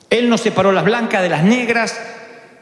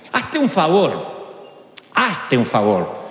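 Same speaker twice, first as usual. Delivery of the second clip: tender plea; 7 semitones lower, much slower than usual. Real, not acted. Tender pastoral appeal.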